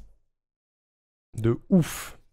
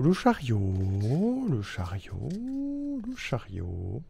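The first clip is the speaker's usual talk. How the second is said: dramatic drawn out; 9 dB quieter, much slower than usual. Real, not acted. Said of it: "drawn out and fading repetition".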